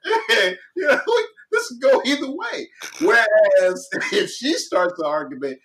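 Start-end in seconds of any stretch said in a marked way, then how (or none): 0.1-2.7 much higher, shrugging mock voice; laughing impression of a shrugging wife; indifferent wife. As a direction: play her as totally unbothered. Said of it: "her mind is like".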